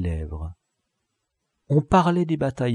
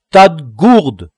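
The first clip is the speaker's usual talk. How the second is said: slow, much louder scornful jab; mock insult; 5 semitones higher, 11 dB louder, slower than usual. A narrator's reading. La crique scolding the baffled gang, loud and slow. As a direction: call them fools, teasing. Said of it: "reprit-il".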